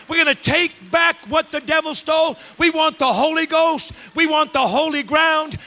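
loud, high, rallying sermon voice; full-force preaching. Real, not acted. Preacher rousing the church to storm the gates, full-force preaching. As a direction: whip up the congregation phrase by phrase.

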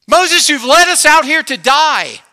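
high, loud wailing complaint; panicked crowd cry. Acted. Voicing panicked Israelites.

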